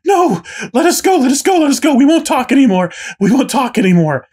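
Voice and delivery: high desperate screams for mercy; terrified prisoners screaming to be let go. A narrator's reading. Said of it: "And they're shouting, screaming".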